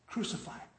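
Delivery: hushed, near-whispered crowd cry; softly, like a far-off shout reaching a cell; muted echo of the mob; heard from cell. Acted. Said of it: "here you hear in your cell".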